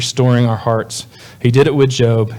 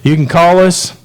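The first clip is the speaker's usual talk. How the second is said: put-on Kentucky accent; 6 semitones higher than usual. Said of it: "with a Kentucky accent".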